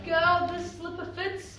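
usual speaking voice

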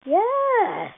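loud, high, triumphant yeah; excited cheer. Real, not acted. Gamer celebrating a great roll, loud high cheer. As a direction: shout it like you just nailed it.

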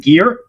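Gear said as English "gear", rhyming with "near"; Gear, Americanized, as a question; American pronunciation. Shown as a demonstration. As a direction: say Gear with an American long "ee" sound. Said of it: "with the American version of it".

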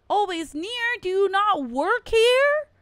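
high, loud and slow, freaked out; disbelieving yelp at the cop; alarmed disbelief. Real, not acted. Streamer realising the cop stalks her, alarmed and loud.

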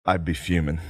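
low, soft mock answer; understated retold answer. Retelling Sam answering the chaperone, low understated voice. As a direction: say it quietly, imitating him. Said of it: "And he goes".

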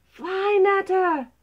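loud, high urgent cry; urgent snake's warning. Acted. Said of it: "hissed the snake".

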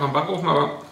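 eating between words; talking while chewing. Real, not acted. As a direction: mouth partly full of food. Seen 1: bites at -4s, holds burger near mouth, still chewing while talking.

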